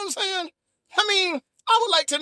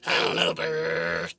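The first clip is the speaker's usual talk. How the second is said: very deep, sulky grumble; low and put out, no mood to talk; grumpy Bert; 11 semitones lower, 3 dB quieter, slower than usual. Pretend. Bert refusing to talk to anybody, deep and sulky.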